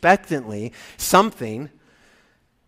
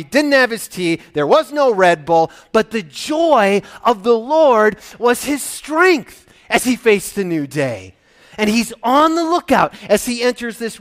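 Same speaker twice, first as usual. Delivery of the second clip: impassioned preaching; 6 semitones higher, 8 dB louder than usual. Real, not acted. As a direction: preach it with mounting fervour. Preaches with rising force.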